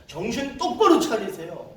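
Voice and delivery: loud, high, emphatic command; forceful rebuke. Retold or imitated. Preacher's parting word to missionaries, forceful rebuke. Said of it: "마지막에 가서".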